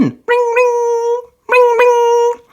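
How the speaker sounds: high ring-ring imitation; phone ringing. A narrator's reading. Narrator imitating the ringing phone, high. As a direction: sing out the phone bell.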